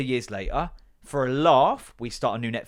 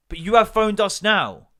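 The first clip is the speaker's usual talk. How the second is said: insistent; 7 semitones higher, 7 dB louder than usual. Retold or imitated. Insisting to Bill on the phone.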